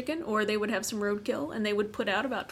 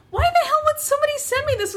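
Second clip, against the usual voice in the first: much higher, louder, laughing mock outrage; exasperated yell; 5 dB louder, faster than usual. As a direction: squawk it out, laughing in disbelief. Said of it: "I didn't mean to yell at you".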